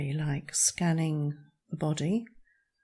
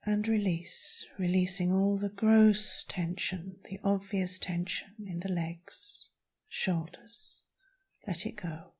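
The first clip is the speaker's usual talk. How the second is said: calm meditation voice with long pauses; guided meditation. Acted. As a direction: guide them gently through each breath, leaving space. Leads a breathing meditation.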